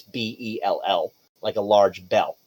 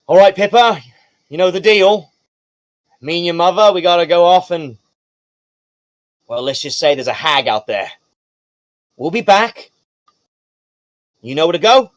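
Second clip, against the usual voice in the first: loud, higher-pitched dad voice for a game character; gruff-loving father figure; 5 semitones higher, 6 dB louder than usual. Acted. Father leaving young daughter at the casino, hearty parental send-off. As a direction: call out parting words as a dad.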